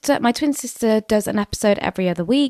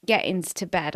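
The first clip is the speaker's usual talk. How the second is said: ordinary talk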